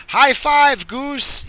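high, loud sing-song greeting; shouted friendly hello to a goose; friendly call out. Retold or imitated. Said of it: "You didn't hear me say".

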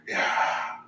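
very soft, voiceless musing aloud; eerie, near-whispered imitation of a caller; imitating faraway caller. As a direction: murmur it hushed, as if staring at the ceiling. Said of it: "he said that very, like".

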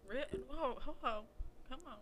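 high, playful indignant protest; mock outrage. Real, not acted. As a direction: pretend to be offended. Mock-offended protest at friend.

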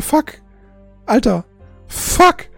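ordinary talk, nothing marked

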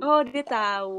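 drawn-out surprised oh; slow, loud realization. Real, not acted. Surprised at the revelation.

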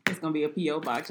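usual speaking voice

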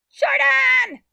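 put-on piercingly high child's yell; very loud, high-pitched frantic call; calling from inside. A narrator's reading. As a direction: scream for your brother from another room. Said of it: "Amy called from inside".

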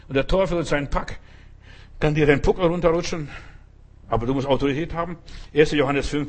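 urgent revival sermon; fiery preaching. Acted. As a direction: preach it hard to the congregation. Full preaching exhortation.

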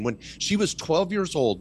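ordinary delivery

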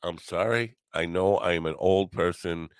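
ordinary delivery

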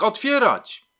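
loud, high shouted command; forceful demand to open up; police shout. A narrator's reading. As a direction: yell the order through a closed door. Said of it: "krzyknął Smyczek".

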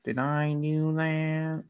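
Steve Urkel impression. Podcaster showing off to co-host, Steve Urkel impression. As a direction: draw every word out in a put-on sitcom voice. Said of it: "I do a good Steve Urkel impression".

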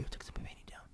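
hushed, nearly whispered mock complaint; overheard muttering. Artist asking her friend who took her painting down, overheard muttering. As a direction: imitate a faint voice from another room. Retold voice heard through studio wall.

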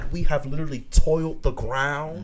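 rising preacher's fervor; preachy voice. Acted. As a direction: preach it, lifting up high. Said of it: "I don't wanna put on my preachy voice".

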